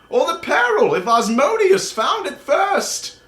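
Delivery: put-on high old wizard questioning the party leader; objecting old wizard. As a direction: push back like a flustered elderly wizard. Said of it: "a little bit more quietly and says".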